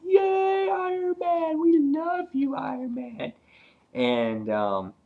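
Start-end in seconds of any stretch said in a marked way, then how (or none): 0.0-3.3 high squeaky crowd cheer; very high mock fan voice, cheering. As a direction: squeal like adoring fans. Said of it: "they're like".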